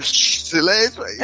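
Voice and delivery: high, strained hush in place of a yell; shouted shushing. Acted. Dad to kids while others sleep, forceful shushing. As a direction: demand silence without being allowed to scream. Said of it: "Eu não posso gritar".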